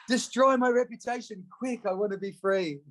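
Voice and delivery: high, jokey voice wanting freedom; mock eager. Joking about the reputation quote, mock eager. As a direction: ham up the eagerness to be free.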